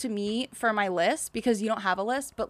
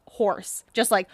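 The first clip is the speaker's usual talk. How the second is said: ordinary delivery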